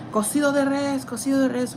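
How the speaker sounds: high, loud call of cocido de res; loud warning call through a busy kitchen; screaming the order. Retold or imitated. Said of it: "Mind you, I'm screaming".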